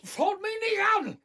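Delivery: angry shout. Acted. Dementer Vater wehrt sich.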